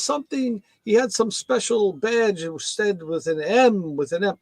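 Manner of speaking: put-on slow light Jamaican accent, high start. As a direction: mailman explaining in a mild Jamaican lilt. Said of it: "So he got this Jamaican accent".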